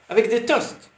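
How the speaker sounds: put-on a keen small boy insisting; lightly voiced eager child. A narrator's reading. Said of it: "ajouta Tidji en hochant la tête avec vigueur".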